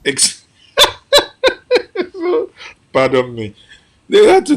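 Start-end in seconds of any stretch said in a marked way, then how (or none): 0.8-2.7 high loud laugh that breaks the sentence; can't hold back a laugh; burst of laughter. Real, not acted. Guest laughing at Zeke's lack of power, loud laugh.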